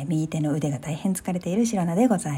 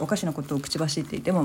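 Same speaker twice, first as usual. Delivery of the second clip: ordinary delivery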